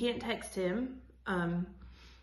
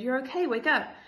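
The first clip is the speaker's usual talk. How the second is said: louder, higher reassuring rousing call; urgent wake-up call; 4 semitones higher, 5 dB louder than usual. A narrator's reading. Annie waking the boy from a nightmare, urgent. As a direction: rouse the sleeper insistently.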